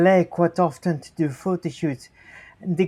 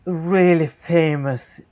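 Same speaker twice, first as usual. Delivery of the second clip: normal talking voice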